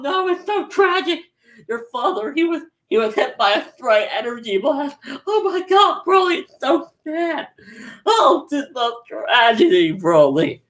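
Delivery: high, wailing mock lament; Frieza's fake grief. Frieza faking sympathy to Broly, hammed-up mock wailing. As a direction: ham up fake sorrow like a villain. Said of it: "in character acting".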